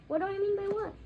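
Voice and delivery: sharp indignant question. A narrator's reading. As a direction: throw it back defiantly. Harry retorts to uncle.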